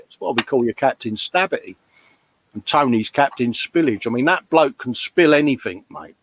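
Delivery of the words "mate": ordinary delivery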